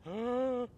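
sharp, playful intake of breath; a hammed-up gasp of awe; mock shocked gasp. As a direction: gasp as if the oath were scandalous. Mock shock at escalating oath.

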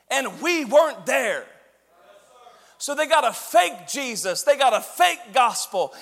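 loud, high, emphatic sermon delivery; fired-up preacher driving each phrase home; military-style preaching. Real, not acted. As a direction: preach it at full force, like addressing troops. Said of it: "preach like I'm in the military".

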